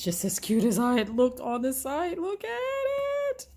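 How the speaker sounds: high, loud, delighted gush. Real, not acted. Gushing over a cute product.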